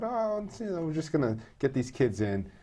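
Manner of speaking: put-on breezy, high voice for the old assumption; naive mock thought. Retelling their early naive assumption.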